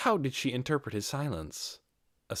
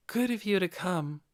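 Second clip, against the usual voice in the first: lightly voiced young female lizard, higher; a calm welcome from the darkness, pitched up; unseen voice greeting; 9 semitones higher than usual. A narrator's reading. Voice in the dark greets the visiting lizardman, lightly higher. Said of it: "a voice said to him from the darkness".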